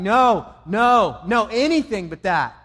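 anguished loud outcry; desperate shout. Retold or imitated. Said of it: "I can imagine him shouting".

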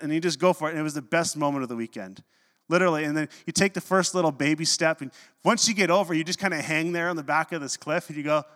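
ordinary delivery

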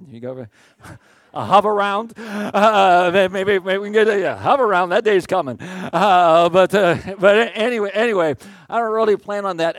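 laughing joke. Real, not acted. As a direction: chuckle as you tease yourself. Joking about Hoveround, laughing.

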